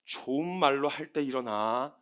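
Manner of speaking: slow, low threat; mock warning. Mother warning her child, slowly and low. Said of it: "나오는 이제 멘트가".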